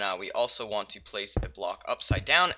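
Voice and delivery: ordinary delivery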